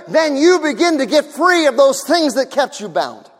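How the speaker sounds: loud, high, driving preaching; a pastor preaching hard to his church about healing; full-force preaching. Acted. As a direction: build and press it on the congregation.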